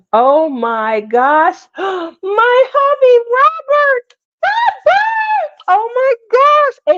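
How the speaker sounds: high squeal of joy calling a name; delighted surprise. Real, not acted. Greeting a long-missed viewer, squealing with delight.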